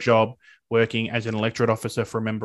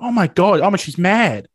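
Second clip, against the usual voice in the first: higher, louder burst of mock alarm; alarmed outburst; 7 semitones higher, 7 dB louder than usual. Hearing the boss say he's fired, a sudden alarmed outburst. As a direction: blurt it out, caught off guard. Boss says she's firing him.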